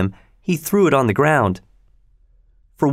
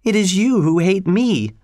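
high-pitched, upset accusation; pained, insistent protest; crying out; 7 semitones higher than usual. A narrator's reading. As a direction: turn the blame back, voice lifted in distress. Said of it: "Cathy cried".

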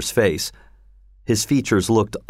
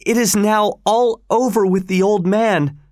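cry out; 11 semitones higher than usual. A narrator's reading. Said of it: "cried Don Juan".

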